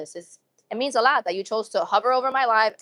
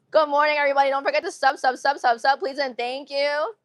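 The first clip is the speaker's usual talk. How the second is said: playful plug voice; 5 semitones higher than usual. Pretend. Streamer asking viewers to sub, playful put-on plug voice. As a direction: do a playful subscribe pitch.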